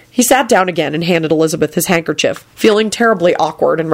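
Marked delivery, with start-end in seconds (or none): none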